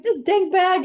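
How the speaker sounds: laughing gripe at the flimsy bag; laughing frustration. Retold or imitated. Shopper laughing at a bag that rips, exasperated. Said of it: "I get so frustrated".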